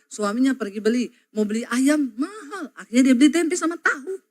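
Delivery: normal talking voice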